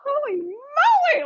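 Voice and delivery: high, drawn-out amazed cry; slow high exclamation of awe; amazed exclaim. Retold or imitated. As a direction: stretch it out in wonder. Amazed as dove follows.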